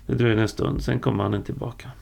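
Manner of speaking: plain reading voice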